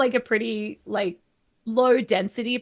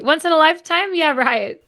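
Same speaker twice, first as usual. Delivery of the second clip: louder, higher mock disbelief; sarcastic; 7 semitones higher, 6 dB louder than usual. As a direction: scoff at the supposed rarity. Sarcasm about recurring recessions.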